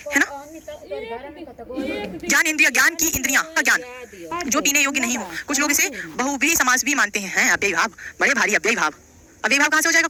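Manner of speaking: ordinary delivery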